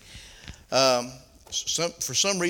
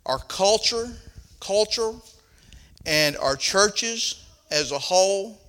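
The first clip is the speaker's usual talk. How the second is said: raised pulpit preaching voice; 5 semitones higher, 6 dB louder than usual. Acted. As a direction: preach it to the congregation.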